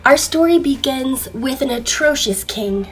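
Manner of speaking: plain reading voice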